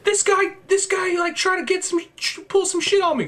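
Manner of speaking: high-pitched mock outrage; indignant character. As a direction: act out a worked-up accuser. Acting out an aggrieved character's protest.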